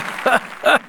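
short, high burst of laughter; delighted chuckle; hearty laugh. Acted. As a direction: laugh out with joy at seeing a friend. Hearty greeting of an old friend.

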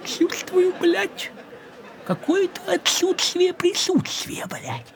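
high unvoiced whisper, spooky; a creeping whispered dread; eerie whisper. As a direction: breathe it out like a ghost story. Creepy feeling while running.

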